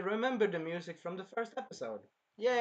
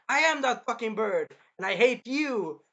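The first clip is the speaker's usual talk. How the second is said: mock angry yell; 5 semitones higher, 7 dB louder than usual. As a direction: spit the words out like an angry little bird girl. Bird girl yelling at spear hero.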